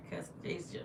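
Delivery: sudden soft whisper; hushed whisper. Real, not acted. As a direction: drop to a breathy whisper on the name. Emotional talk of Jesus on stream.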